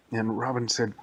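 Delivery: ordinary delivery, nothing marked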